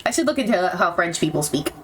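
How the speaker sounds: usual speaking voice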